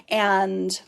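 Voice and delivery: usual speaking voice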